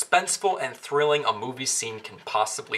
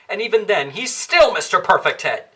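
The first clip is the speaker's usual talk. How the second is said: ordinary delivery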